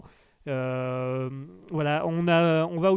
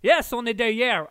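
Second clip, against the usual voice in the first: higher, louder audience member chiming in; voce del pubblico; 6 semitones higher, 7 dB louder than usual. Acted. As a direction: pipe up loudly as the crowd. Plays the audience answering him.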